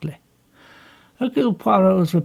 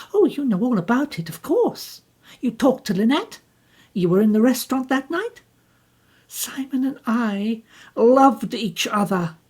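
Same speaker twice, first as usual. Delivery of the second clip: higher, bitter and defensive; bitter young woman; 8 semitones higher than usual. Acted. Jacqueline bitterly tells Poirot what happened. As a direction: push back at him with bitterness.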